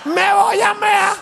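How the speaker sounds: very high, laughing squeal of a drenched guy; high-pitched giggly rant about wet clothes; laughing soaked Venezuelan. Retold or imitated. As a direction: play it as a joke while dripping wet.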